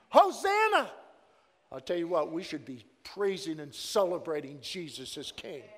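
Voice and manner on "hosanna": very high, loud ringing cry. Retold or imitated. Jesus answering the Pharisees, stones crying out loud. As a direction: let it burst out like stones crying. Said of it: "the stones will cry out".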